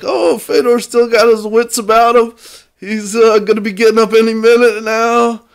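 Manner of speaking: mocking Russian announcer. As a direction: ham up a commentator covering for his fighter. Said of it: "making it sound like it wasn't that bad".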